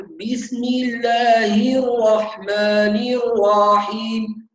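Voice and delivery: slow, stretched-out reading; overstretched. Shown as a demonstration. Teacher exaggerating the stretching mistake for students. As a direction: drag out every vowel too long. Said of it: "بيقرأ بهذه الطريقة".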